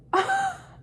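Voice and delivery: sudden high-pitched cackling laugh; high burst of laughter. Real, not acted. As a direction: break into a shrieking laugh. Bursts out laughing at his line.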